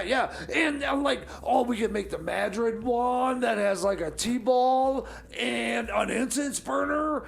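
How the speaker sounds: normal talking voice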